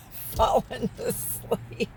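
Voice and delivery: breathy laugh through the words; slow, voiceless words squeezed out in laughter; laughing at yourself while talking. Real, not acted. Laughs at herself dozing off.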